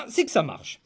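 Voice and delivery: ordinary talk, nothing marked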